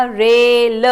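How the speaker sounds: ordinary delivery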